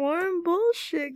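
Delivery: high, slow drawn-out joke; playful pun. Real, not acted. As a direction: stretch it out as a goofy pun. Joking pun on warm-up.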